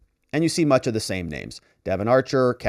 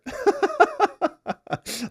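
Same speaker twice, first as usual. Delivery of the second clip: real laugh burst out high; high, amused laugh. Real, not acted. Mocking the lawyer's letter, he bursts into high laughter.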